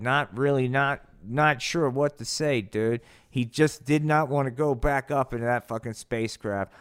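usual speaking voice

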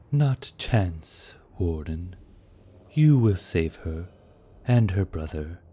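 a hint of a knowing old lord; Eladin, wise elder. A narrator's reading. Eladin answering the warden, lightly voiced sage.

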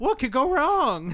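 high, stretched-out mock optimism; naive enthusiasm. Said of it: "where someone's like".

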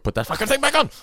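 high-pitched hammed-up demand; mock angry kid. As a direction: imitate a furious child's tantrum. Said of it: "he looks at you like".

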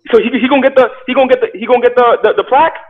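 high, loud, stumbling over the words fast; panicked repetition as it sinks in; alarmed disbelief. Retold or imitated. Alarmed at losing the plaque.

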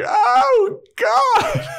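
very high, loud anguished groan; mortified Buffy. Retold or imitated. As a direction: daughter dying of embarrassment. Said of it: "And Buffy's like".

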